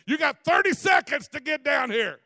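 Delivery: high, loud revival shout; preaching like Steve Hill. Retold or imitated. Preacher shouting an altar call at the congregation, loud and high. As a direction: bark the deadline at the crowd. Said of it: "I was preaching like Steve Hill".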